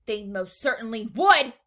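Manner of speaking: loud, emphatic retort; cried out. A narrator's reading. Mother disputes that no one would buy, cried out. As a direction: insist on it loudly. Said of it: "cried Missus Gloop".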